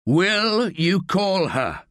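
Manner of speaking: slow, high pleading plea. A narrator's reading. Staff begging Johnson to call Sally, pleading slowly. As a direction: beg him to make the call. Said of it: "pleads Staff".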